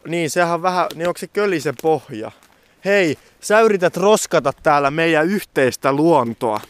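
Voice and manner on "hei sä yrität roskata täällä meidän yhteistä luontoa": louder, higher playful telling-off; a hammed-up outraged nature guardian; mock scolding. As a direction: scold them like a fussy park ranger. Mock scolding over litter.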